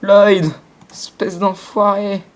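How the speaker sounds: loud call, then slow insult; raging gamer. Real, not acted. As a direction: yell the name, then drawl the insult. Insults a player by name.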